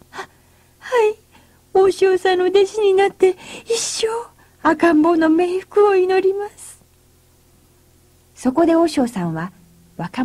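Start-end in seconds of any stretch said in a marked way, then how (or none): 0.1-6.8 put-on timid young men. A narrator's reading. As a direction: stammer it out in fright. Young men submit fearfully. (高くおびえた若者の声)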